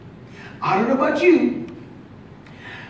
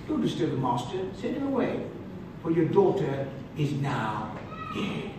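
hushed bad news; 8 semitones lower, 10 dB quieter than usual. Messenger bringing death news.

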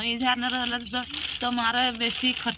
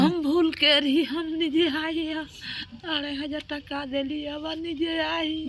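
real crying; 4 semitones higher than usual. Real, not acted. Distraught mother lamenting her mistake to helper, crying. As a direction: wail it out, drawing the words long. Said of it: "आप तो रो रहे हो बैठ के".

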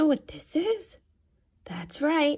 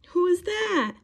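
ordinary delivery, nothing marked

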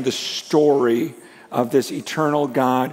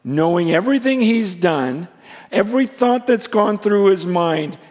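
higher and louder, swept up in wonder; amazed preaching; 6 semitones higher, 6 dB louder than usual. Real, not acted. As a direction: preach it out, astonished at the grace. Preacher building to amazed climax.

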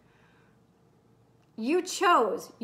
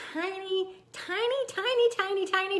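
high-pitched rapid repetition of tiny; tiny little voice; 6 semitones higher than usual. Real, not acted. Coach describes doing small actions, tiny high voice. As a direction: make the word sound small and squeaky.

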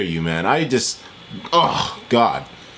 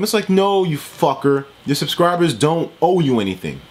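normal talking voice

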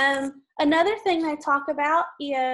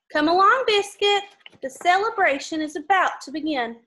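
lively storybook read-aloud; reading to children; 4 semitones higher, 4 dB louder than usual. Acted. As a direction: read it brightly for little kids. Reading picture book to kids.

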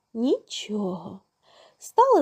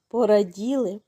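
ordinary delivery, nothing marked

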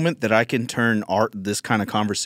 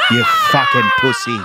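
screamed much higher through laughter; mock shout; 7 dB louder than usual. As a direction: yell it until the voice cracks with laughing. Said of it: "that voice in your head".

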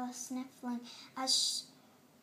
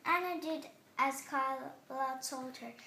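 ordinary delivery, nothing marked

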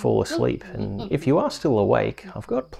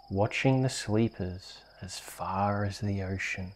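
breathy hush, softer; whisper; 3 semitones lower, 8 dB quieter than usual. Acted. Reading to a child drifting off, whispering. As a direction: whisper it like a secret.

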